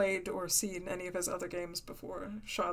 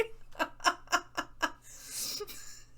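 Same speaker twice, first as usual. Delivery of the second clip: airy high giggle; breathless high laugh; 10 semitones higher, 3 dB quieter than usual. Real, not acted. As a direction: laugh without voice, high and helpless. Laughing at stuck accent.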